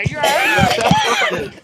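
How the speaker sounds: sudden high shout of alarm; worried shout. Real, not acted. Friend yelling to a choking friend, alarmed shout. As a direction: yell out to check on him.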